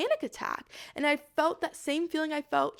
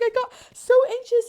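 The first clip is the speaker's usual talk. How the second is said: high, loud wailed confession, acted; tearful outburst; 12 semitones higher, 11 dB louder than usual. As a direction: act out a crying meltdown. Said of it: "I'm, like, crying".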